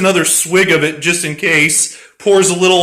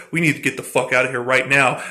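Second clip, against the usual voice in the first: hurried low urgency; 7 semitones lower, 6 dB quieter, much faster than usual. Retold or imitated. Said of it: "Borknir's like".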